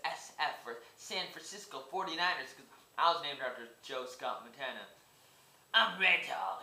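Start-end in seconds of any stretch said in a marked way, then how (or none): 5.7-6.6 a hint of a tough gangster, louder; gangster Red Dog voice. Vlogger introducing his gangster character, lightly tough and louder. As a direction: announce yourself like a movie gangster. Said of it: "gangster named Big Red Dog".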